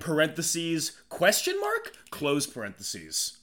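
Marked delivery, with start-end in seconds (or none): 1.1-1.9 "question mark" pitched sharply up; questioning lift. Pretend. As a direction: say "question mark" as a high rising question. Reads punctuation aloud as a question.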